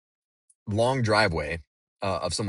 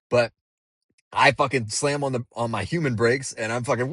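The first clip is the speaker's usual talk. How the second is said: ordinary talk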